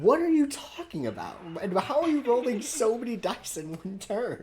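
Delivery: high, loud incredulous protest; exasperated disbelief, voice shooting up; baffled outburst. Real, not acted. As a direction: demand an explanation in loud bewilderment. Baffled player protests at the jargon.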